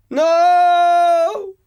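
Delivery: very high, loud anguished scream. Acted. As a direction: shriek as she melts away. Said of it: "I screamed".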